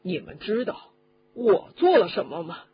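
plain reading voice

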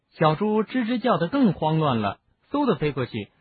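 ordinary delivery

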